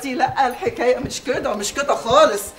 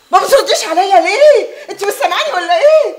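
loud, high, impatient scolding; fed up at being ignored; exasperated demand; 6 semitones higher, 8 dB louder than usual. Acted. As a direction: demand an answer with raised voice. Scolding companion for not answering.

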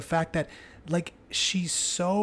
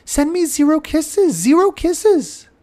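much higher and louder, zero kisses drawn out slowly; passionate; 14 semitones higher, 11 dB louder, slower than usual. Retold or imitated. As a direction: urge the children with playful passion. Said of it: "I repeat in an almost passionate tone".

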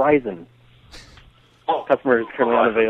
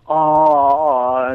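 mock whine; 4 semitones higher, 8 dB louder than usual. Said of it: "all she goes is like".

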